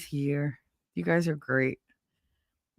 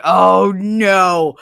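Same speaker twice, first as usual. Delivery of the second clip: loud, drawn-out dismay; imitating an appalled friend; horrified reaction; 2 semitones higher, 8 dB louder than usual. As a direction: groan it out loudly in mock horror.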